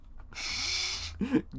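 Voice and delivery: high, breathy wheeze of laughter; wheezing laugh. Real, not acted. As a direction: dissolve into voiceless laughter. Laughs at own impression.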